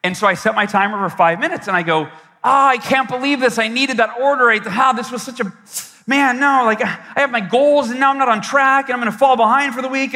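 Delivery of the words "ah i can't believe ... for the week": raised, exasperated venting over a lost sale; frustrated rant. Retold or imitated. Salesman ranting to himself after a cancelled order, exasperated. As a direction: vent the frustration, pitched up.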